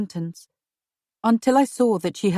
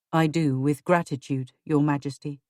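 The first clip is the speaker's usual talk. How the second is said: lower, grave husband voice; husband's voice; 5 semitones lower than usual. Acted. Husband warning his wife of treason, lower and grave. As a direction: voice a worried husband, lower and steady.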